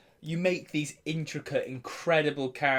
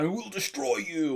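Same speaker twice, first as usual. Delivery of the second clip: raised, hammed-up villain threat; big bad guy; 5 semitones higher than usual. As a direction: declare it like a stock arch-villain. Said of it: "big bad guy that's like".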